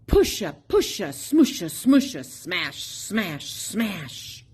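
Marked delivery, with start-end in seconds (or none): none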